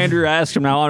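normal talking voice

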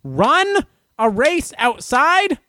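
high, slow incredulous rant; exasperated disbelief. Real, not acted. Runner ranting about race rules, exasperated and incredulous. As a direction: drag each word out high in disbelief.

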